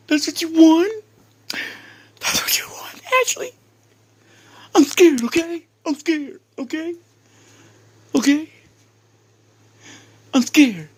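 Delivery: high, loud whiny mockery of a scared wimp; mocking whiny coward. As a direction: squeal it like a frightened crybaby.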